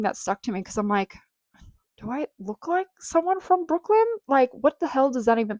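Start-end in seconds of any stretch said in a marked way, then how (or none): 2.0-5.6 high-pitched, incredulous retort; half-joking outrage at being taken for a local; mock indignant disbelief. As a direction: replay your baffled reaction, pitch way up. Retold indignant reaction to locals.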